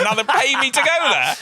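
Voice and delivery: high-pitched amazed question; astonished, half-laughing comic outburst; incredulous. Retold or imitated. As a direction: ask it high, laughing in disbelief. Incredulous at being paid to attend.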